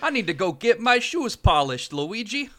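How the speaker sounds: high-pitched Italian-American plumber, hammed up; Mario. Co-host plays Mario chatting to his brother, high comic Italian-American voice. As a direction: pitch it well up, mock Brooklyn Italian-American. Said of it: "the Italian American voice".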